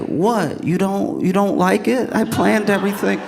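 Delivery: high-pitched, baffled defence; wounded protest. Acted. Man defending his surprise plan to a stunned date, acted wounded protest. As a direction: act out a hurt, clueless suitor.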